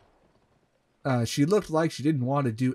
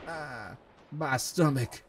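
slow, softer pretend groan; mock groan; 5 dB quieter, slower than usual. A narrator's reading. Annie complaining to Colton of her stomach, mock groan. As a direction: drag it out weakly, as if your belly hurts. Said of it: "she groaned".

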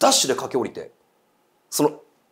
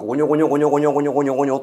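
fast mumbling, now louder; growing murmur; 5 dB louder, much faster than usual. Retold or imitated. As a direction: let the mumbling swell up close. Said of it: "どんどんどんどんその声が".

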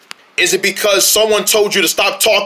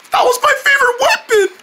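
high, tearful cry over a loss; wailing. Acted. Wailing over losing the favorite weapon. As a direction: wail it like a heartbroken kid.

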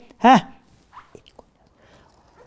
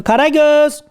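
higher-pitched name call; wake-up call; 5 semitones higher, slower than usual. Acted. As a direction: call out to rouse a sleeper. Uyuyanı seslenip uyandırıyor. (uyandırmak için tiz seslenme)

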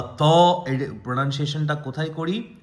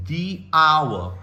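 "the hour" drawn out very slowly; slow demo. Shown as a demonstration. Teacher slowly models the hour for students. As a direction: stretch "the hour" out slowly for learners. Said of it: "next dance hour".

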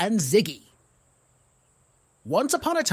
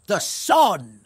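a loud, triumphant call; shouting answer; 6 dB louder than usual. Acted. Zebra and zucchini shout the riddle's answer, loud. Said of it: "finally shouting".

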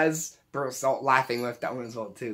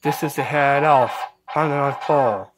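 robotic elf voice, louder; voice changer elf; 4 dB louder than usual. Shown as a demonstration. Demo for viewers, robotic elf greeting. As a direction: announce yourself as the head elf through the gadget. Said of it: "Just like that".

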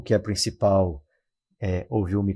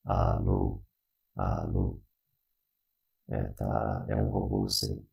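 much lower, buzzing robot voice; half robot voice; 11 semitones lower than usual. Shown as a demonstration. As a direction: voice changer robot filter. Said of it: "a famosa voz de robô".

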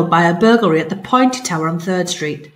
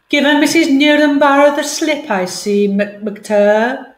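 cat boss; 6 semitones higher, slower than usual. Acted. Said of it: "she said".

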